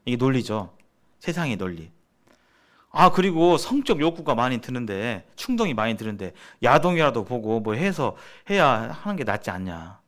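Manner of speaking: ordinary delivery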